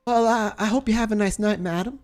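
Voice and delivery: high, put-on polite voice; embarrassed nurse Susie. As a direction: stumble through it up high.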